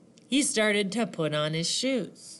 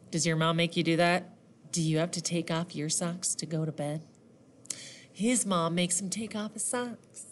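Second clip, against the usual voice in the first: usual speaking voice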